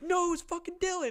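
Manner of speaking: high, loud mock protest; panicked denial. Chris blaming his friend to angry dad, panicked denial. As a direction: shove the blame away in a hammed-up panic. Friend hastily passes blame.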